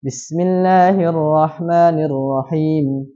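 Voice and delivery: slow tilawah, two-beat ending; Quranic recitation. Shown as a demonstration. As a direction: recite ta'awudz evenly. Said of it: "Harus dua semua akhir ayatnya". (tilawah ta'awudz dua harakat)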